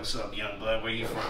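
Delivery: soft, low, lightly raspy imitation; Harry's raspy voice. Older dancer sizing up a young kid, lightly imitated raspy voice. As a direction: imitate his rasp gently, keep it low. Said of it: "he's always had that raspy ass voice".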